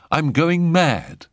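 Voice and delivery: high, slow, drawn-out bitter confession. A narrator's reading. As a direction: announce it bitterly and dramatically, stretching it out. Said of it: "said Jack bitterly".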